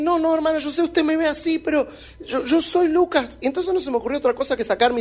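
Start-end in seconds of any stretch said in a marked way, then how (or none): 0.0-3.4 insistent pleading. Retold or imitated. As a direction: push hard, he won't be turned away. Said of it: "otra vez le tuve que insistir". (insistiendo en tono agudo y suplicante)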